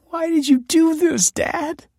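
high, tearful plea; asking her father through tears; weeping. A narrator's reading. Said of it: "she wept".

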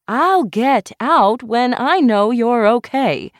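high, loud, slow comeback; insistent retort. A narrator's reading. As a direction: stand firm over a shouting patient. Doctor stands up to shouting patient.

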